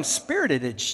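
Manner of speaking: "spirited" said as spirit-aged, echoing man-aged; made-up word. Shown as a demonstration. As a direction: add an extra "-aged" ending to "spirited". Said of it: "I know that's not a word".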